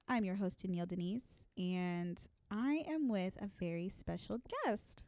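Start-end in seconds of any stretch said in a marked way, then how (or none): none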